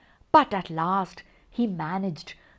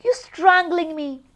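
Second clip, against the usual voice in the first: choking; 7 semitones higher than usual. A narrator's reading. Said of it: "gasped the snowman".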